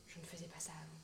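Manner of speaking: sudden drop to near-whisper; hushed aside. Acted. Mother catching herself on what she used to do, hushed. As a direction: murmur it low, as if to yourself. Sudden private realisation, dropped voice.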